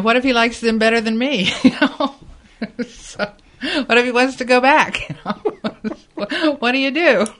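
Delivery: high, loud, laughing through the words; comic alarm, cracking up between questions; laughing worry. Real, not acted. Mother jokes about her worries.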